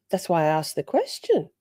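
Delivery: ordinary delivery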